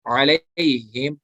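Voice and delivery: अलैहिम drawn slowly with a soft या लीन; slow soft recitation. Shown as a demonstration. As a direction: ease softly through अलैहिम. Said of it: "नरमी से पढ़ेंगे".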